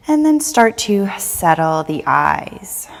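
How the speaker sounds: unhurried meditation cueing, phrase by phrase; guided meditation. Real, not acted. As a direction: guide them gently into stillness, leaving space between phrases. Leads a settling meditation.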